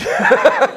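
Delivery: bursting out laughing, loud and high; a big happy laugh. Real, not acted. Laugh after hell of a ride.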